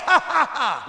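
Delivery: loud high scornful ha ha ha; mocking laugh. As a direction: cackle at him like he's beaten. Mocking laugh after taunt.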